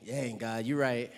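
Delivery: dropped low, soft admission; sheepish concession. Retold or imitated. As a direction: mumble it, caught out. Conceding to God.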